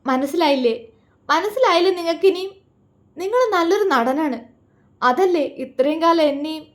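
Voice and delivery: high, loud tearful accusation; bitter sobs at his deceit; wife accusing husband through sobs, bitter; sobbing bitterly. A narrator's reading. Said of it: "അവളൊന്ന് തേങ്ങി".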